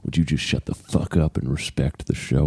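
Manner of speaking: lightly voiced documentary old man; grumpy old man. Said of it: "can you use an old man voice like you're in a Ken Burns documentary".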